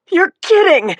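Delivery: high, loud incredulous shout. A narrator's reading. Injured woman shouting at the detective. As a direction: shout it at him. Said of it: "she shouted".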